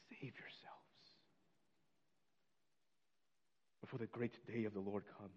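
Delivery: near-whisper, with a long pause midway; hushed warning. Real, not acted. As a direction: let the warning drop almost to nothing. Solemn hushed repeat of the warning.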